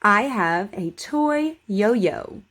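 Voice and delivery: slow, careful model sentence for repeating; over enunciating. Shown as a demonstration. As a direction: say each word clearly and slowly. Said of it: "Just repeat after me".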